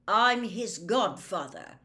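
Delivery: loud, slow, firm assertion. A narrator's reading. Godfather insisting on his right to stay, loud. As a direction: stake your claim loudly. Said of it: "louder than ever".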